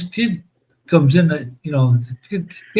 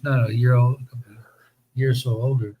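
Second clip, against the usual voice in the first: whispered aside; 3 semitones lower, 5 dB quieter than usual. Real, not acted. Quiet correction aside.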